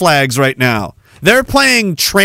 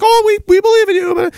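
much higher, sugary mock voice; mocking supporter. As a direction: parody empty encouragement. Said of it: "And they're like".

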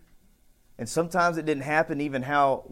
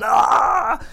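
high, loud mock scream; mock yell; 9 semitones higher, 9 dB louder than usual. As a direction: yell like the possessed man. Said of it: "He's yelling".

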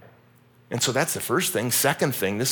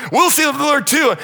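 much higher, loud eager reply; people answering eagerly; 9 dB louder than usual. Retold or imitated. The people echoing Joshua, loud eager reply. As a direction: shout back like an eager crowd. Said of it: "The people are like".

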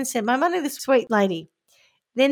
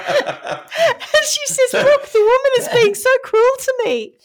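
high, giggling, breaking voice; can barely get the words out for laughing; laughing through it; 14 semitones higher, 8 dB louder, slower than usual. Real, not acted. Telling the friends the funny chat, laughing hard.

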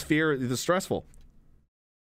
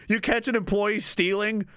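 ordinary talk

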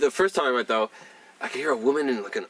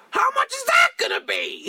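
much higher, louder hammed-up shout; mock shriek; 4 dB louder than usual. Patient panicking at the dentist's price, mock shriek. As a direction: scream it in comic panic. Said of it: "she just loses her mind".

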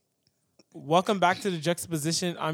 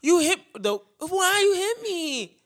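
high, drawn-out mock whine; hammed-up, slow, high-pitched protest; Bernice; 11 semitones higher, slower than usual. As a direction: imitate a wronged child complaining. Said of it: "she'll be like".

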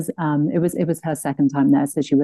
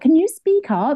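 calling out much higher, pleading; high mock plea; 10 semitones higher than usual. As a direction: ask her to speak up in a high pleading tone. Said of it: "we would all just be like".

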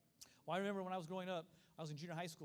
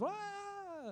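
long, high mock wail; panicked wail; 14 semitones higher than usual. Preacher imitating us letting go of the wall, wailing. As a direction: imitate a flailing swimmer's cry.

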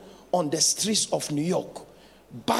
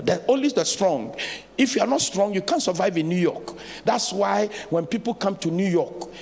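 normal talking voice

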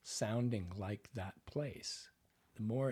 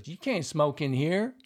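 louder, higher, upset delivery; upset line; 4 semitones higher, 9 dB louder than usual. Shown as a demonstration. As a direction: say it like you're upset. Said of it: "like you're upset about saying this".

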